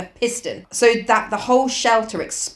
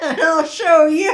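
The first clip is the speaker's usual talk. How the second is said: laughing; 7 semitones higher, 4 dB louder, slower than usual. Pretend. Mock tough voice, laughing.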